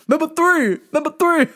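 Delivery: imitating customer. Repeating customer's phrase.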